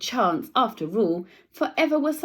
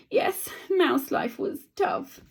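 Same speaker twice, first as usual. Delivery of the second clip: usual speaking voice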